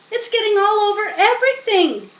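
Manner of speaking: put-on high, fast, loud child's shout. A narrator's reading. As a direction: yell it fast as an upset girl. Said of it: "shouted Fern".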